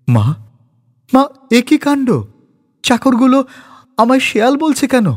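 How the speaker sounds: high, near-crying complaint; tearful voice. Acted. Son complains tearfully to his mother about the servants. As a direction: whine it like a hurt son. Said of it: "সে কাঁদো কাঁদো স্বরে বলে".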